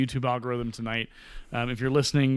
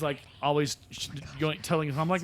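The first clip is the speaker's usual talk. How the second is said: ordinary talk, nothing marked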